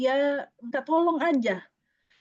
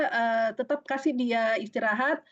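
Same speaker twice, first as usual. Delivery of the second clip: ordinary delivery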